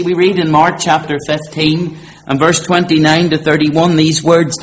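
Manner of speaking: ordinary talk, nothing marked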